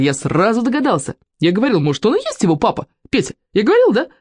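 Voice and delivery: put-on eager high-pitched boyish insisting; excited yelling. A narrator's reading. Вовка appeals to Петя to back him up, excited. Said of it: "завопил Вовка".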